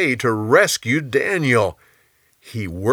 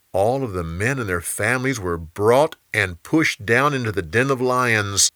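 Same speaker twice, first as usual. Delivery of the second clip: usual speaking voice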